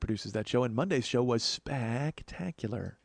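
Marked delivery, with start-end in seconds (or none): 1.4-3.0 spectacular with a long extra A, spaaak-tacular; drawn-out spectacular. Real, not acted. Host raving about Monday's show, stretched spectacular. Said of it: "I threw an extra A in there".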